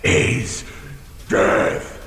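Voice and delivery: whispered ominous threat; menacing breathy whisper. Acted. Dragon threatening the thief with his breath, ominous whisper.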